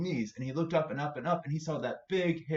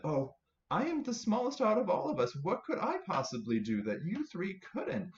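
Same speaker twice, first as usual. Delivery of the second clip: small high voice; 8 semitones higher than usual. Acted. Tiny Spider speaks.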